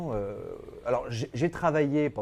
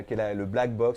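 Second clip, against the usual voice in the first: normal talking voice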